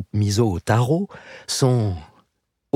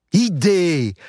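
calling out; 9 semitones higher, 3 dB louder than usual. A narrator's reading. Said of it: "lança Nagare".

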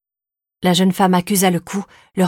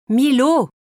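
higher-pitched, stern call of the name; sharp reprimand; 7 semitones higher, 3 dB louder than usual. A narrator's reading. Exasperated mother to her dawdling son, sharp. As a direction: call a child to order, curtly. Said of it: "assèna-t-elle sèchement".

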